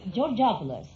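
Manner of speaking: normal talking voice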